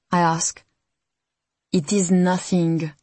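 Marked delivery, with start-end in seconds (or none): 1.7-2.9 put-on slow, light French accent. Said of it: "says Remy in her thick French accent".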